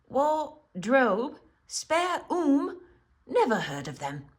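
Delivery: puzzled faun. A narrator's reading. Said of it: "said Mister Tumnus".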